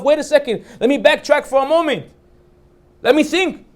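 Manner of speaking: urgent. Retold or imitated. Voicing introspection, high and loud.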